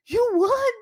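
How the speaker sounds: high, loud "you won!"; a friend yelling the good news; excited shout. Retold or imitated. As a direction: shake her and shout the news. Retelling excited shout of congratulations.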